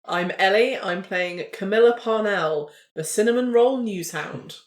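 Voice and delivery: ordinary delivery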